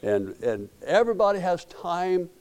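ordinary delivery

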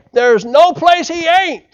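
lightly voiced country boy, high and slow. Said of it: "as the country boy would say".

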